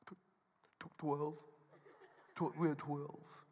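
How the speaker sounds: stammering, timid little answer; quiet, halting mumble; sheepish disciples. Acted. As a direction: stumble over the reply like a scolded pupil.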